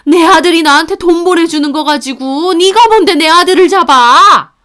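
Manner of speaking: high, loud furious yelling; mother-in-law bursting in yelling at daughter-in-law; screaming mother-in-law. Acted. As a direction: scream it at her, pointing. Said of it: "삿대질까지 하면서 뭐라고 하셨어요".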